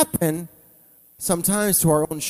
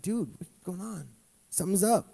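soft placating; 9 dB quieter than usual. Retold or imitated. Calming angry friend.

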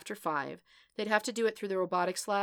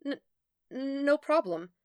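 slow, stammered, higher reply; drawn-out, uncertain politeness; hesitant mutter; 7 semitones higher, 3 dB louder, slower than usual. A narrator's reading. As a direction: stall on the first word. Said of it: "Karen muttered".

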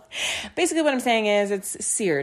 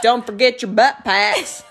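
grandpa announcement; 5 dB louder, slower than usual. Retold or imitated. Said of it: "all of a sudden he's like".

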